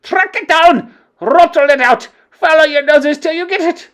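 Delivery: high, loud screamed order; screaming witch queen. Acted. Grand High Witch ordering the hunt, screaming. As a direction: scream the command. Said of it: "screamed the Grand High Witch".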